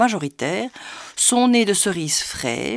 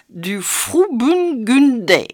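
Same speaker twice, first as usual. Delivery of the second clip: slow, careful German-style Frauburgunder; German accent attempt; 6 semitones higher, 5 dB louder, much slower than usual. Shown as a demonstration. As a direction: spell out Frauburgunder slowly with a German accent, loud and unsure. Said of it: "Excusez-moi de l'accent".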